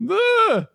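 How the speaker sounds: short high yelp; quick shriek standing for the girl; mock girl's scream. Naming the girl by her scream, high mock yelp. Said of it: "So now I have".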